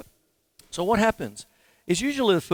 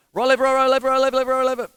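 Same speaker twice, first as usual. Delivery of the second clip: high, loud "Right, left" count, each word hit; counting every stroke; 9 semitones higher, 7 dB louder than usual. Shown as a demonstration. Teacher showing the wrong way to count strokes, high and loud. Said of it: "instead of thinking".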